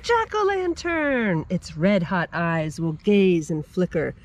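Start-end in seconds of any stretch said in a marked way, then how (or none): 0.0-1.4 excited exclaim. Real, not acted. Child repeats answer excitedly.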